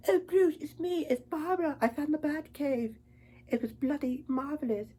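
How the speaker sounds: hammed-up British accent. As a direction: put on a girlish British accent. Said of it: "cast someone to play British".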